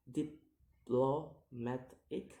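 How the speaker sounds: "diplomatic" slowly split into syllables; slow, separated syllables on "diplomatic". Shown as a demonstration. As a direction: sound out "diplomatic" piece by piece. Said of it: "count the word syllable backwards".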